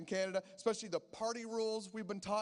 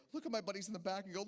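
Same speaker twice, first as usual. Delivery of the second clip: ordinary delivery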